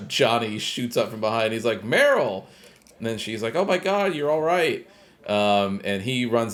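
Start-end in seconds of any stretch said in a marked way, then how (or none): 1.8-2.4 raised, higher call of a name. Retold or imitated. Retold call to Meryl on rescue. 3.5-4.9 high-pitched imitation of relief; relieved exclamation. Retold relief at seeing him alive.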